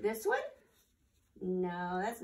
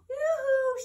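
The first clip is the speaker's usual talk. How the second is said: very high, loud sing-song yoo-hoo; shouting; 10 dB louder than usual. Acted. Mouse waves and calls to friends from the bank, high loud yoo-hoo. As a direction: call out gaily across the water. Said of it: "she shouted".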